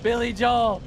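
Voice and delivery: high voice broken by laughter; laughing. Real, not acted. Gamer tickled by the boss nickname, laughing. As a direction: crack up on the name. Cracks up at [name].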